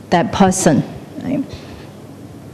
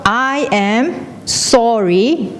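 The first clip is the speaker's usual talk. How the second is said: mock shout; 2 semitones higher, much slower than usual. Someone on a cliff shouting an apology, drawn-out call. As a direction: call it out word by word, stretched. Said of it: "if you shout".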